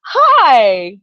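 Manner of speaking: light up on one big hello; delighted greeting. Retold or imitated. Singer turns to Janie and lights up, imitated delight. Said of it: "his face lights up, and he goes".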